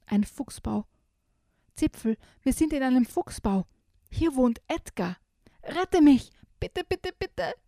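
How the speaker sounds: rising to high frightened begging; trembling plea. Acted. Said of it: "fleht Mütze zitternd".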